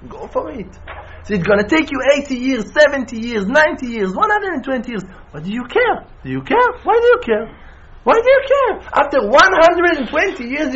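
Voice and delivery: high-pitched, urgent exhortation; impassioned preaching. Real, not acted. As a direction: preach insistently, pitch pushed right up. Preaches at full force.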